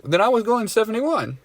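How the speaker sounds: mock-innocent, high-pitched reply; high-pitched agreement. Meekly agreeing with the cop.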